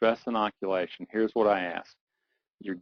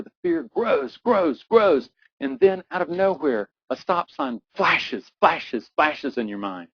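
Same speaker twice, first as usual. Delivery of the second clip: louder and much higher, climbing to a near-cry peak; building intensity; 3 semitones higher, 4 dB louder than usual. Acted. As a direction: ramp the fear up until it breaks. Fear builds to peak again.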